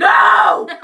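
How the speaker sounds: high, loud cry of no; real laughing outcry; loud protest. Real, not acted. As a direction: shout to stop her. Interrupting in protest.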